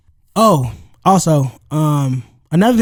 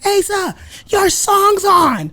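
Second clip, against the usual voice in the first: high, slow sing-song wife call; high mock call of his name; quoting wife; 12 semitones higher, much slower than usual. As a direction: mimic her calling out over the party. Wife calling over party noise.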